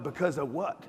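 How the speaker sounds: ordinary delivery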